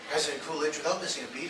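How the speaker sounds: normal talking voice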